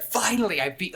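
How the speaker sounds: high, loud start of a victory cry; acted triumph. Man finally right after being warned all along, triumphant. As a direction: crow at a long-awaited win.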